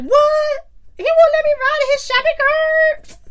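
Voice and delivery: put-on high, loud, whiny drunk outrage; drunk indignation. Drunk friend refused a cart ride, whiny and loud. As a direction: play the offended drunk friend.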